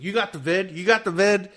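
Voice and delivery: high, loud teasing question; joking taunt. Retold or imitated. Coworkers ribbing a sick colleague, mock teasing. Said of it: "they're all joking with me".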